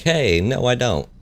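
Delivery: squeaky high no; high mock refusal. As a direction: whine it up high. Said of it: "And I'm like".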